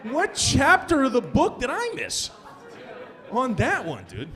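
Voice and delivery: high, drawn-out disbelief; as if he truly missed a chapter; incredulous. Acted. Incredulous rant at audience.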